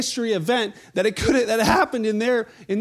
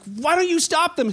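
higher, mock-indignant demand; indignant critics; 7 semitones higher than usual. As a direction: whine it like a huffy leader.

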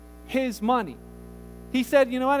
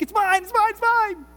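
1 greedy whine; 10 semitones higher, 4 dB louder than usual. Retold or imitated. Greedy voice imitated.

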